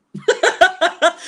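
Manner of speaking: loud high burst of laughter; laughing. Real, not acted. Laughing at calling her a liar, loud high laugh. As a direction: cackle out a long laugh.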